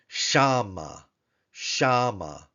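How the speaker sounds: Shama, stress on SHA; teacher modelling Shama; Hebrew Shama. Shown as a demonstration. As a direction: say Shama with an open ah. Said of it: "expressing a location".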